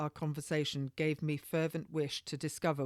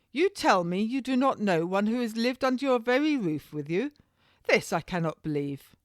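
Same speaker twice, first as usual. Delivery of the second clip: loud, higher disbelief; incredulous officer; 6 semitones higher, 6 dB louder than usual. A narrator's reading. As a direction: scoff at her denial. Waller disbelieves her.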